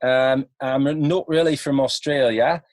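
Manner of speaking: an American's best go at Australian; attempted Australian accent. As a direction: put on an amateur Aussie accent. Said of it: "in my best, uh, attempt of an, an accent".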